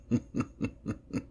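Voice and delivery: quiet, menacing villain laugh; soft, drawn-out evil snicker; demonic chuckle. Acted. Demon scorns the mortal summoner.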